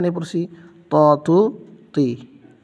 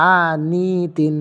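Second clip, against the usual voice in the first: slow syllable by syllable; much slower than usual. Shown as a demonstration. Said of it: "আশা করি বুঝতে পারছেন।".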